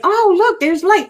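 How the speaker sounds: very high, delighted voice; delighted ghost. Retold or imitated. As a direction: gasp with joy at the light. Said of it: "He's like".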